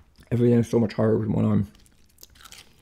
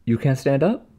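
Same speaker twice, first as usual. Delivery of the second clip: high, incredulous nurse voice; a snippy nurse, lightly voiced; mocking mean nurse; 6 semitones higher than usual. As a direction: mimic her disbelief with a sneer.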